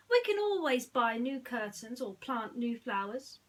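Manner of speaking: loud, breezy reassurance; Aladdin, upbeat. Acted. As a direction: wave off the damage cheerfully. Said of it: "Aladdin said".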